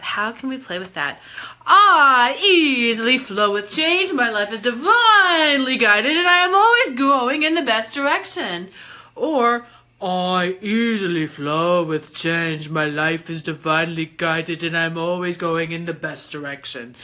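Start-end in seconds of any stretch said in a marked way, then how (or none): 1.7-8.7 loud playful affirmation. Shown as a demonstration. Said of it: "why I am changing my voice like that". 10.0-17.0 low voice. Shown as a demonstration. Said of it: "why I am changing my voice like that".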